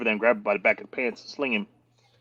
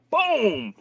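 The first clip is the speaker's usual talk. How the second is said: high boom; 3 dB louder than usual. Retold or imitated. Shove in story.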